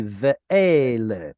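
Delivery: ve'ele slowly, stress on the middle syllable "E"; penultimate stress. Shown as a demonstration. Teacher showing students where stress falls, ve'ele with penultimate stress. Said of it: "Hebrew word with penultimate stress".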